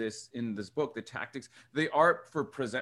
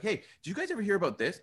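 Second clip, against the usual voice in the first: casual upbeat; 8 semitones higher, faster than usual. Retold or imitated. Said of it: "They're like".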